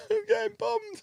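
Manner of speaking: tiny high falsetto wail through laughter; mock cry for help. Acting out a shout for help.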